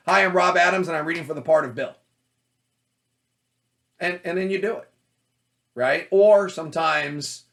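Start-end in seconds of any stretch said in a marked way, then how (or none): 0.1-1.9 bright, upbeat self-introduction; confident louder audition slate. Shown as a demonstration. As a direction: announce yourself to the casting room. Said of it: "Representing you".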